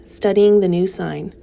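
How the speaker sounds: plain reading voice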